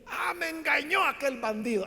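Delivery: exclamación indignada, más aguda, con quiebre de llanto; grito furioso fingido. Pretend. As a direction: imagina a Isaac estallando contra el bandido. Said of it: "Isaac no pudo decir". (Isaac fingido grita que Jacob lo engañó, furioso)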